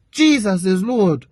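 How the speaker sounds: ordinary talk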